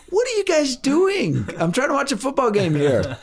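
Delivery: high, slowed, exasperated dad voice; annoyed dad. Retold or imitated. Dad trying to watch football, exasperated and high. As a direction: play the put-out father missing his game.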